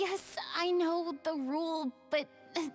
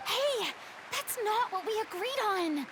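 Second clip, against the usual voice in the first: raised pitch in startled objection; indignant protest; 6 semitones higher than usual. Acted. Performer named against her wishes at curtain call, protesting. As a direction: object as if caught off guard.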